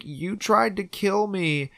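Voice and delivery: mock wail, slow and high; retold bawling. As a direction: draw out the accusation in imitated anguish. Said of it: "he starts to bawl".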